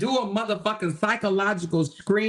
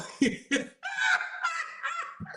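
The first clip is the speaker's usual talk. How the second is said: high, breaking laugh after her own rhyme; 6 dB quieter than usual. Real, not acted. Cracking up at her own chant, high laugh.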